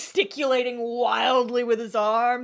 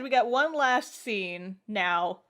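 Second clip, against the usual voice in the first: usual speaking voice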